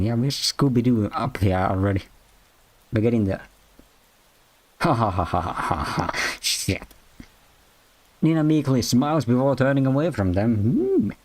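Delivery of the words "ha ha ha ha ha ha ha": low mock laugh. Awkward laugh after Scooby-Doo joke.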